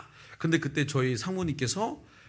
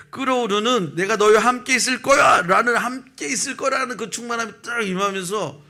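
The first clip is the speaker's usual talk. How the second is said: rising fervor; 8 semitones higher, 6 dB louder than usual. Acted. Preacher swells on God's promise.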